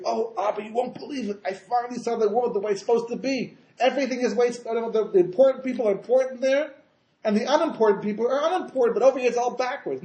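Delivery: acting out a son bursting with news for his father; high, insistent rush of amazement; excited report.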